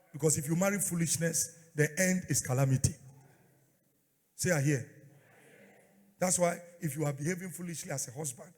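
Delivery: loud preaching at full force; fiery preacher sermon. Acted. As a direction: preach it hard to the crowd.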